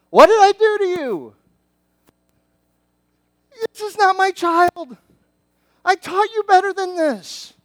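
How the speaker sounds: high, loud, pained parent asking what went wrong; exasperated parent. Acted. Parent voicing God to disobedient children, high and pained. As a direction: plead like a hurt, baffled parent.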